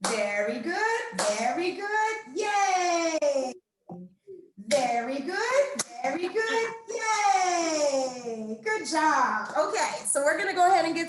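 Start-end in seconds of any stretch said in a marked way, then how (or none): 0.1-3.5 slow then high, shouted yay; rising cheer. Shown as a demonstration. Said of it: "cheer chant again". 4.7-8.6 rising cheer. Shown as a demonstration. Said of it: "cheer chant again".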